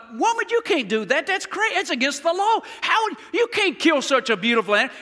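high, alarmed mock protest; a shocked newlywed crying foul; indignant husband protest. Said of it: "and I thought".

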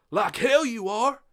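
high, loud and slow angry farmer; furious outburst. Acted. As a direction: bite each word in defiance. John grabs the reins defiantly.

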